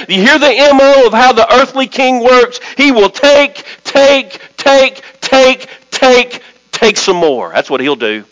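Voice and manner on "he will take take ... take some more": each "take" punched separately, pitched up, dropping at the end; stress on repeated TAKE. Shown as a demonstration. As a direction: hammer every "take" with a gap after it. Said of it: "I'm gonna emphasize the word".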